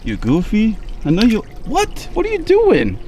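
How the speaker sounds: high excited voice for the fish; pet talk. Real, not acted.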